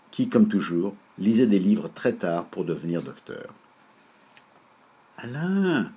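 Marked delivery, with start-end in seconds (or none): 5.2-5.9 soft call. Acted. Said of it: "cria très doucement Lizou Bizou".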